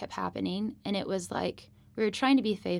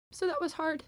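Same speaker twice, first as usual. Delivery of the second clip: getting emotional; 8 semitones higher than usual. Real, not acted. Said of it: "getting emotional".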